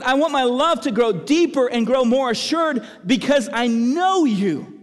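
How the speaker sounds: high, loud, fervent preacher voice; full-force preaching. Acted. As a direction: cry it out like a prayer from the pulpit. Preaching at full force, voicing prayer.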